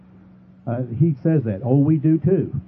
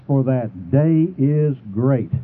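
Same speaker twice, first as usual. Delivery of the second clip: for that day is great, drawn out slowly; solemn slow scripture reading; slow emphatic reading; much slower than usual. Shown as a demonstration. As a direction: read it slowly, weighing each word. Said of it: "Please note the word".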